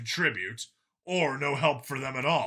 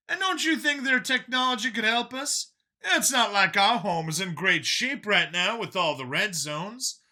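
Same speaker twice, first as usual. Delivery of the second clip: higher, lighter voice for Brian; Brian's voice; 9 semitones higher than usual. A narrator's reading. Brian pushing back at Vincent, higher voiced. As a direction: voice the younger friend Brian, a bit higher. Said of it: "Brian speaking in story".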